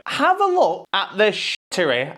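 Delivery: high, scornful scoff; incredulous fan disgust. Real, not acted. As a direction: pitch it up in outrage at the shirt. Disgusted rant at new kit.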